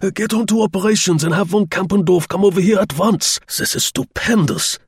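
high, loud German general; excited shouting. Acted. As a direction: shout orders to someone behind you. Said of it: "He shouted to someone behind him".

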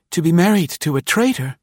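usual speaking voice

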